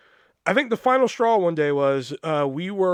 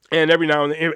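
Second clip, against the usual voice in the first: normal talking voice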